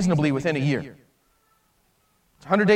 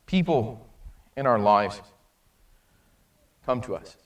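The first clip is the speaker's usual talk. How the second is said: hushed preaching; 8 semitones lower, 7 dB quieter than usual. Real, not acted. Preacher turning to the congregation, soft and low. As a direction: pull the room in quietly. Quiet sermon climax.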